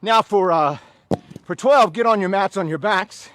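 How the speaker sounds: loud count and command; shouted workout call. Real, not acted. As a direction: bellow the instructions to the class. Trainer calls out count and instructions.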